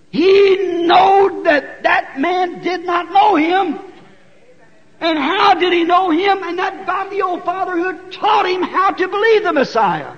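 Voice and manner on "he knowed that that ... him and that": preaching shout. Real, not acted. Preacher drives home point.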